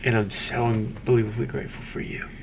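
soft, breathy grateful whisper; emotional whisper. Real, not acted. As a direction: whisper it, moved and sincere. Whispered heartfelt thanks to wife.

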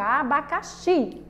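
"abacaxi" drawn out slowly, leaning on XI; slow teacher's "abacaxi", final XI held; stress on final syllable. Shown as a demonstration. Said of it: "Onde é que está a sílaba mais forte?".

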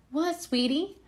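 ordinary delivery

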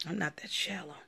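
whispered repeat of I'm not that shallow. Real, not acted. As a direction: breathe the denial.

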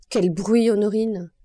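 loud scolding call across the room; loud stern rebuke; angry call. Acted. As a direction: snap at her for the noise. Madame Lepic scolds Honorine.

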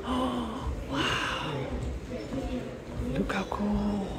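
hushed, awed whisper. Real, not acted. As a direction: whisper so as not to disturb. Whispering in the temple.

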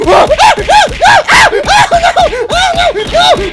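very high loud shrieks; terrified screams. Acted. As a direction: scream in terror. Freddy attacks Chica.

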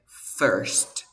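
"first" with an E-type vowel; teacher models "first" with an E sound. Shown as a demonstration. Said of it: "se pronuncian con la misma vocal".

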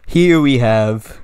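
loud, hammed-up American host voice; American vlogger. Host greets viewers of totally American channel, exaggerated American accent. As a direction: boom it out like an American YouTuber, slowing down. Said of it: "Okay, I'm American now".

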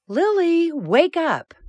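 high, loud, calling mother; Mama's wake-up call. Acted. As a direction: call your child out of bed, bright and loud. Mama wakes Lily in the morning.

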